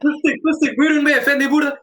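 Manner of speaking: high, loud Turkish street vendor call; Turkish accent. Retold or imitated. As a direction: hawk pistachios in a Turkish accent. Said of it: "I use the Turkish accent".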